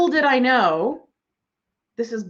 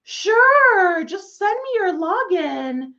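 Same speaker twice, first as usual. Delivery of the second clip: cheery helpful yes; 7 semitones higher, 4 dB louder, slower than usual. Retold or imitated. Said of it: "I'd be like".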